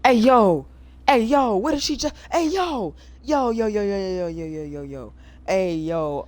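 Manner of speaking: high, loud string of "yo"s; bursting disbelief, far over the top; shocked yelling. Real, not acted. Streamer reacts to the passenger's revelation with shocked loud yells.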